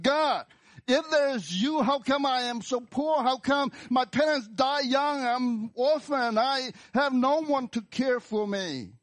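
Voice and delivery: high, loud cry of protest; angry at God. Acted. Suffering orphan demanding answers from God, angry shout. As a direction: hurl bitter questions upward, pitched very high. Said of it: "you bring out your anger at God".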